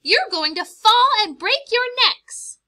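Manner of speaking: very high, loud scolding; Mama Bird scolding the chicks hanging off the nest; scolding mother bird. Acted. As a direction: yell at them to get back.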